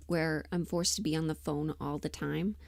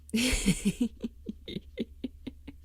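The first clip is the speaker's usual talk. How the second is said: airy high giggle held back; breathless giggle; 4 semitones higher than usual. Real, not acted. Streamer finding something funny, stifled giggle. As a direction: giggle under your breath. Giggling at what she found.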